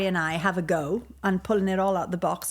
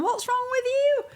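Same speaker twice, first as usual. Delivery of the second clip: much higher mock incredulous friends; mocking moviegoers. Host imitating people teasing her for crying at the film, much higher. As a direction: squeal it like baffled friends teasing. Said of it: "were going".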